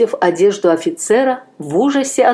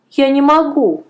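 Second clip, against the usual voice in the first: put-on firm refusal in a higher boyish voice; firmly; 8 semitones higher, 3 dB louder than usual. A narrator's reading. The boy firmly refuses to pray to the gods. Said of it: "твёрдо сказал мальчик".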